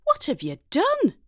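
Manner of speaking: high, appalled question; a mother horrified by the new look; mock-tragic mum; dismayed mum.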